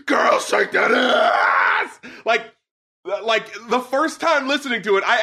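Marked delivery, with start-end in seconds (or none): 0.1-2.0 slow, drawn-out mock yell; mock scream. Reviewer imitating the screamed lyric, mock yell. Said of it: "screaming these lyrics".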